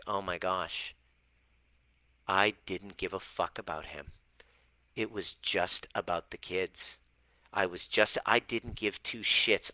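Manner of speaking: soft, subdued retold admission; quiet confession. Coach voicing a client's hard realization, quiet confession. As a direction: drop the volume, as if owning up.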